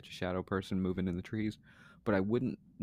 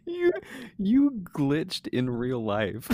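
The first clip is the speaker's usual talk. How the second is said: slow, louder, laughing; 10 semitones higher, 8 dB louder, much slower than usual. Real, not acted. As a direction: drag it out mid-laugh. Laughing at host's glitch.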